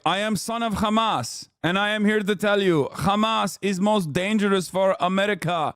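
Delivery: mock son of Hamas accent. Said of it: "The guy who's like".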